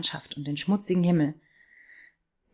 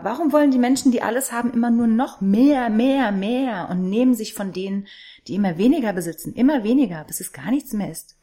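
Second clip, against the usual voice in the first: higher, louder, rising indignation; bitter outburst; 5 semitones higher, 3 dB louder than usual. Acted. Girl raging at the rich who take everything, indignant outburst. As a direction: rail at the greedy, voice climbing.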